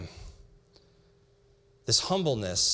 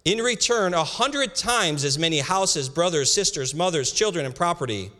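scripture reading; 4 semitones higher, slower than usual. Acted. Reads scripture slowly.

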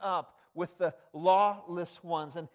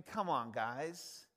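ordinary talk

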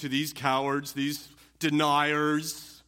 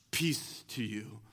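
soft tender blessing; 6 semitones lower, 8 dB quieter, slower than usual. Acted. Jesus greeting troubled disciples.

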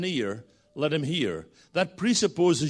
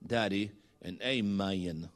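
put-on slow, drawn-out Southern accent; imitated Southern accent; 6 semitones lower, 5 dB quieter, slower than usual. Preacher quoting Philip's youngest son, in a slow Southern accent. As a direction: mimic a little boy's Southern drawl. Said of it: "three words he says".

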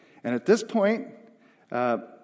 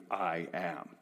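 low, hushed, weighty "I am"; "I am" as a grave, quiet declaration; emphatic; 8 semitones lower, 9 dB quieter than usual. Acted. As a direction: set "I am" down slowly and solemnly. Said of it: "that is utterly emphatic".

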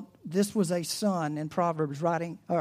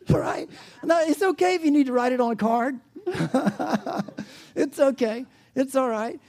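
higher, louder laughing reassurance; 7 semitones higher, 6 dB louder than usual. Real, not acted. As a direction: laugh it off kindly. Laughing at congregation.

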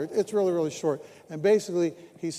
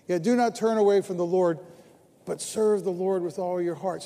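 louder, proclaimed; preached scripture; 6 dB louder than usual. Acted. Pastor reading Samuel to the church, proclaimed louder. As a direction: proclaim the verse to the room. Reads Samuel's words in the sermon.

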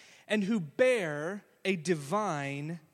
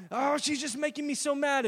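put-on high, pleading child voice; mock whine of a cross daughter; whiny kid complaint; 7 semitones higher than usual. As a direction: tattle like an upset little girl.

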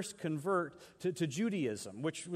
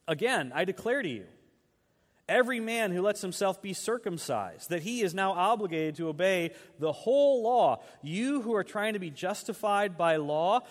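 forceful scripture reading; 2 semitones higher, 5 dB louder than usual. Acted. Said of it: "He picks up in verse three".

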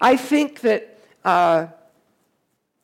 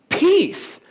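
commanding rebuke; 9 semitones higher than usual. Acted. Jesus rebuking the storm.

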